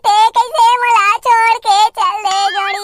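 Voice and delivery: theatrical fake crying for a lost love; hammed-up wailing. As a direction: wail it like an overdone farewell. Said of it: "तोर जोड़ी ला विदा नहीं करना है".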